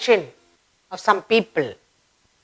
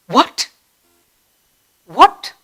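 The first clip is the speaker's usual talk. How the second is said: rising, startled what; surprised; 2 semitones higher than usual. Shown as a demonstration. Teacher showing children a surprised what. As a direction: act out sudden surprise. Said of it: "When there's a surprise".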